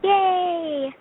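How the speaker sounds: high, bright shout of joy; excited cheer. Real, not acted. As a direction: cheer it out loud. Child cheers at caller.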